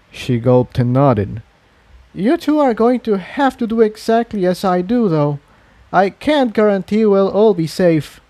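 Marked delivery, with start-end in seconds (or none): 2.1-8.2 higher, lighter voice for the mare Tiptoe; nervous Tiptoe. A narrator's reading. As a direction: pitch up into a nervous young mare's voice. Tiptoe voices her nervous warning.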